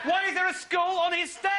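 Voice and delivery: high, loud, laughing disbelief; incredulous shout. Acted. Comic telling the audience about the scary man, incredulous shout. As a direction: hit the punchline like you can't believe it.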